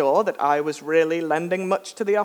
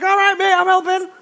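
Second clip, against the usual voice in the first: strained reassurance; 13 semitones higher, 5 dB louder than usual. Retold or imitated. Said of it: "I'm like".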